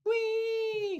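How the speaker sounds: a very high gleeful squeal; gleeful whee. Acted. Vlogger voicing the pumping chart, a high gleeful whee. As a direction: squeal with joy as the price flies.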